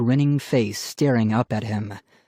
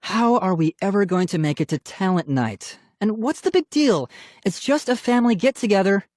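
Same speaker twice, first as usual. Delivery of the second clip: higher fretting complaint; exasperated; 8 semitones higher than usual. A narrator's reading. Mom fretting about talent night, exasperated. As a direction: complain as a fed-up parent.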